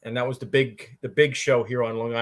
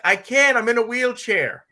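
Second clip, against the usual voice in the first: high, raised yell in imitation; yelling back; 10 semitones higher, 5 dB louder than usual. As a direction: holler it back across the room. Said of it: "yells back".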